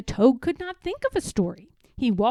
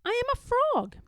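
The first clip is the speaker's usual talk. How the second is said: much higher, light little frog voice; Frog's voice; 12 semitones higher than usual. Acted. Frog correcting Toad, in a high voice. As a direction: pipe it up as the frog. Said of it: "said Frog".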